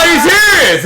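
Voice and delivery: high, full-voiced yell of disbelief; incredulous shout. Yelling at the actor in the video, incredulous shout. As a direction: explode at the screen in disbelief.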